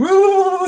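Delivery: high-pitched mock wail; exaggerated, comic boo-hoo howl; mock crying wail. Confessing movie crier imitates herself at Finding Nemo, mock wail. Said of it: "I cry like a bitch".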